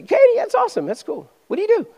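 high-pitched, hammed-up friendly interest; eager small talk. Preacher acts out chatting with Katie, high eager interest. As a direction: jump up high as if delighted to meet her.

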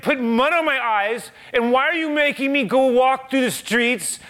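high, loud frustrated questioning; exasperated pleading. Retold or imitated. Imagining oneself as the blind man, pleading high and loud. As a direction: voice the man's bewildered protest to God. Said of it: "I'm imagining, if I'm there".

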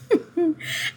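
bright burst of real laughter. Real, not acted. Laughing at her own old habit, a high real laugh. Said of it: "I'm laughing because I used to do this".

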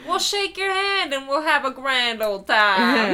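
high, loud hokey pitchman; folksy announcer. Acted. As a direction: sell it like a cheesy host. Hokey convention invite.

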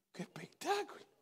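hushed in wonder; awed whisper. Real, not acted. Preacher marvelling at the father's faith, whispering. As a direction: breathe it in amazement.

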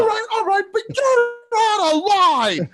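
imitating Bob's "you're out of line"; mocking Bob. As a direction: ham up his tough-guy line. Bob's comeback repeated, mocked.

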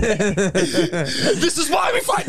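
slow, high protest through laughter; laughing mock wail. Grown child to mom mid-argument, laughing mock protest. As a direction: drag it out high while cracking up.

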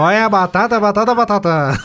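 sing-song hawking of potatoes; market barker call. Retold or imitated. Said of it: "Daí ele falava". (pregão de feirante, mais agudo)

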